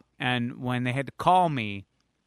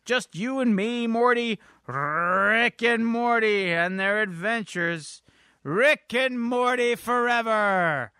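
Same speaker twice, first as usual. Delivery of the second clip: high, drawn-out cartoon voice; slow, hammed-up cartoon chant; Rick; 7 semitones higher, slower than usual. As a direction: stretch it out into a grand high proclamation. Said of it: "Rick, uh, says".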